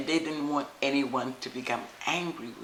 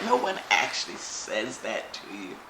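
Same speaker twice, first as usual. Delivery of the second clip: ordinary delivery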